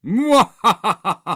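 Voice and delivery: loud, high evil cackle; Dizzy Dave bellow. A narrator's reading. Boy spooking his friends, bellowed cackle. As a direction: bellow a villain's mwahaha. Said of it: "bellowed Dizzy Dave".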